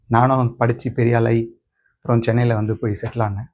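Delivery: ordinary delivery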